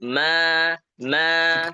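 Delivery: "ma" held long with a light open a; thin mim. Shown as a demonstration. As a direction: draw out "ma" without rounding it. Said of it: "dibaca tipis mimnya".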